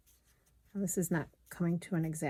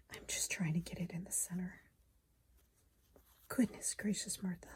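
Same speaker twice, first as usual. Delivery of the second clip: whispering; 11 dB quieter than usual. Real, not acted. Muttering to herself while sewing.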